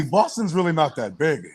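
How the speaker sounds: ordinary delivery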